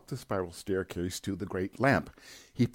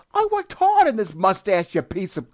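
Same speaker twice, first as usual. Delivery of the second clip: much higher, louder enraged yell; furious shouting; 13 semitones higher, 10 dB louder than usual. Acted. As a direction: scream at the bird. Furious at seagull.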